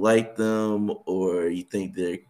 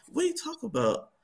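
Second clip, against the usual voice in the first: much higher and fast, playing dumb; mock innocent denial; 3 dB quieter, faster than usual. A husband caught looking, playing innocent to his wife. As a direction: act like you've no idea what they mean. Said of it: "and you just say".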